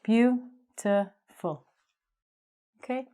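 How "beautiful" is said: "Beautiful" slowly split into three separate syllables. Shown as a demonstration. Teacher showing students three syllables, "Beautiful" slowly broken apart. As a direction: break "Beautiful" into beau, ti, ful with pauses. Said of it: "is only three syllables".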